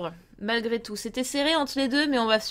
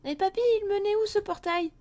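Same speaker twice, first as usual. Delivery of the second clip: put-on much higher little kid voice; child voice; 9 semitones higher than usual. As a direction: play a doubting grandchild. Child voice talking to grandpa.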